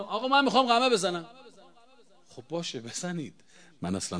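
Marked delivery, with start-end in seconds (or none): 0.0-1.3 higher, faster insistent voice of a zealous mourner; mimicking a pleading follower, pitched up and quick; imitated eager mourner. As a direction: play the stubborn devotee who wants to strike. Mourner insisting on self-flagellation.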